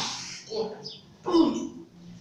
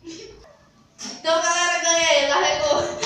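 excited; 4 semitones higher, 8 dB louder, slower than usual. Real, not acted. Wins the challenge, cheering.

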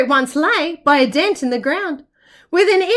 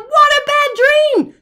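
high, loud despairing yell; wombat yelling; 10 semitones higher, 5 dB louder than usual. Acted. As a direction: shout it out in dismay. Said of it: "The hairy-nosed wombat yelled".

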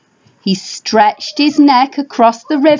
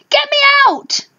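high mock yell to get out. Father dinosaur stuck in mud, high mock yell. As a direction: call it out, pitched up. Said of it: "he yelled".

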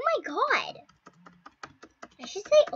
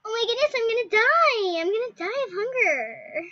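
higher, louder hammed-up alarm; dramatic panic; 8 semitones higher, 6 dB louder than usual. Real, not acted. Kid panicking that her character will starve. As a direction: wail it dramatically. Hunger meter dropping.

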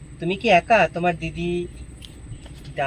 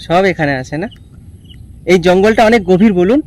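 loud, clear raised voice; speaking loudly; 14 dB louder than usual. Real, not acted. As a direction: project it to a hard-of-hearing man. Talking loudly to a deaf old man. (জোরে জোরে বলা)

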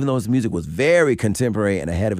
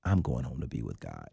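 dying friend, hushed; 7 semitones lower, 10 dB quieter than usual. Retold or imitated. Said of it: "He said".

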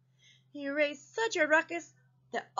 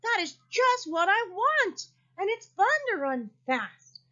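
delighted; 7 semitones higher than usual. A narrator's reading. Joyful Armadillo after shouting.

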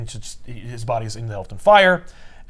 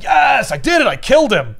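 triumphant gloat; 11 semitones higher, 6 dB louder than usual. Retold or imitated. Villain gloating over kill.

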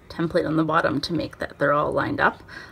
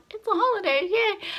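excited cheer; 13 semitones higher than usual. Real, not acted. Cheering about the day off.

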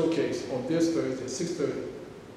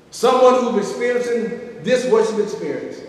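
ordinary talk

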